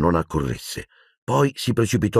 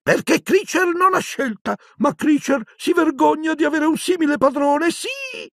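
very high, bitter elf whine; resentful house-elf. Acted. Servant elf bemoans having such a master, resentful and high. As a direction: complain in a high put-on creature voice.

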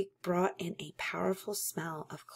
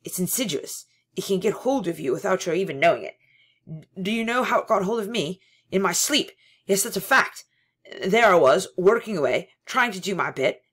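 agitated confiding; 3 semitones higher, 9 dB louder, faster than usual. Acted. Parsons confides his story.

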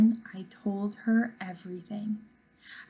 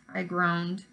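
plain reading voice

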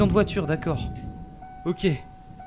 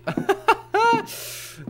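nervous laugh. Real, not acted. Nervous laugh after scare.

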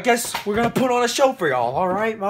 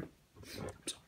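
whispered apology; 28 dB quieter, slower than usual. Real, not acted. Apologizing after outburst.

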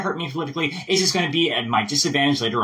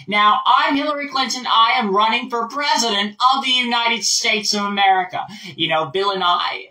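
higher, declaiming American politician; Hillary Clinton; 4 semitones higher than usual. Acted. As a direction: announce yourself like a candidate at a rally. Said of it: "here's Hillary Clinton".

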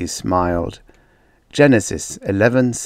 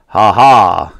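big booming "Ha ha!", much louder; roaring laugh; 10 dB louder than usual. A narrator's reading. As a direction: let it roar out like a bear. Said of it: "he roared".

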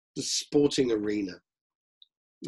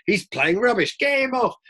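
crowd jeer; 2 semitones higher, 3 dB louder than usual. Retold or imitated. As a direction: yell him off the pitch. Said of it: "you're having this".

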